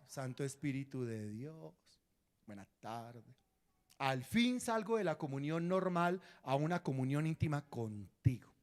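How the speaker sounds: saludo suave, grave y pausado; háblale bajito a Dios, con calma; oración íntima. Shown as a demonstration. Muestra cómo saludar a Dios conversando. (oración en voz baja y serena)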